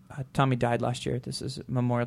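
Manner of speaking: softly answering a stranger's question about her tattoo. Retold or imitated. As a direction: hush it down as if it still hurts. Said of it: "she said it very soft".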